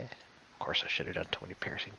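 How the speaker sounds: fast whispered aside; whispering. Real, not acted. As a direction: mutter it to yourself. Muttering regret to self.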